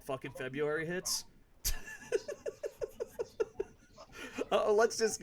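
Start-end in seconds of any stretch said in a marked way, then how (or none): 1.6-3.6 high-pitched soft giggle. Real, not acted. Laughing at his own February joke, high giggle. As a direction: let out a squeaky laugh.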